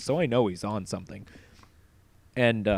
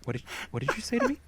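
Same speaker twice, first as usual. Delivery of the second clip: scolding mom; much faster than usual. Retold or imitated. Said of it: "my mom would just look at her and be like".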